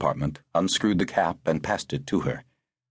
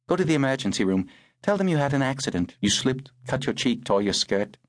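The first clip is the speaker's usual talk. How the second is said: lightly voiced woman, higher pitch; woman firmly instructing; 6 semitones higher than usual. Woman coaching a shaken girl on her cover story, firm. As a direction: give orders in a higher woman's voice.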